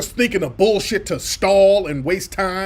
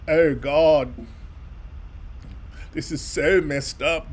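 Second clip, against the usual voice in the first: lightly voiced laid-back surfer; surfer dude; 4 dB quieter than usual. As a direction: drawl it like a surfer guy. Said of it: "Surfer dude now".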